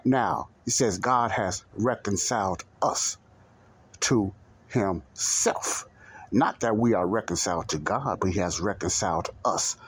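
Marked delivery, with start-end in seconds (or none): 4.0-5.9 "to himself" drawn out very slowly, word by word; stress on "to himself". Shown as a demonstration. Preacher to congregation on who reconciled whom, emphatic. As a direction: spread out "to himself", a pause between the words. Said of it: "Put emphasis on that".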